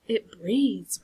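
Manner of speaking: plain reading voice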